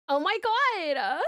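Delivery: put-on slow, drawn-out valley girl gasp. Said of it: "Valley Girl song".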